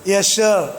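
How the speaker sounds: low pupil's answer, lightly acted; reply low as a dutiful student; a hint of obedient student. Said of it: "jawabnya apa, [name]".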